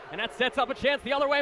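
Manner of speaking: normal talking voice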